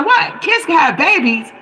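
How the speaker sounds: high-pitched incredulous question; naive astonishment. Retold or imitated. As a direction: retell your shocked disbelief, jumping up in pitch. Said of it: "That show you how naive I was".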